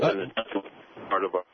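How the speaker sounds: choppy phone line cutting out; breaking up. Real, not acted. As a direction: voice dropping out on a bad line. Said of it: "you're breaking up a little bit there".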